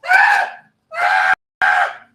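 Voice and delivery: loud imitated scream; retold scream. Comic imitating a woman at a rave, screaming. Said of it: "She starts screaming".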